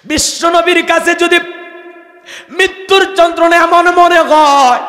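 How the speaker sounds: very high, loud exhortation; full-force preaching. Real, not acted. Preacher calls to the Muslims of Bogura, loud exhorting. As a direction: cry out to the whole crowd.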